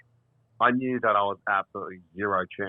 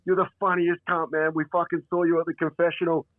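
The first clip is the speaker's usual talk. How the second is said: higher-pitched imitation of eager fans; excited fans; 6 semitones higher than usual. Three dudes spot the comedian on cue, excited fans. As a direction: mimic star-struck blokes, pitched up. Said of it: "these three dudes were like".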